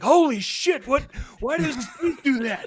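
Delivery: high, loud, panicked alien yelp; startled shout. Retold or imitated. Alien examiner shocked by falling dentures, yelping high and loud. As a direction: jolt back in shock at the falling teeth. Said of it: "Like, they were startled".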